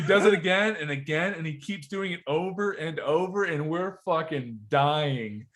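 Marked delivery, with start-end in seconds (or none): none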